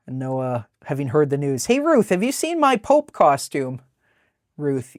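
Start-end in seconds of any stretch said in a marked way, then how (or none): 1.7-3.8 excited call. Acted. Noah calls out to Ruth.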